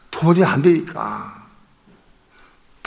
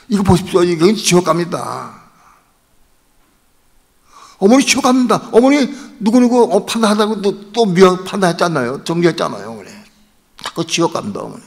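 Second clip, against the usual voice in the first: insistent, raised-pitch pleading; urgent pleading; 6 semitones higher than usual. Retold or imitated. Son warning his mother about hell, urgent pleading.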